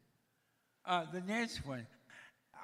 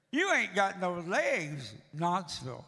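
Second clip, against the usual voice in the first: put-on louder, slow blunt kid voice; mock kid; 8 dB louder, slower than usual. Boy at the pool blurts out about my legs, loud kid voice.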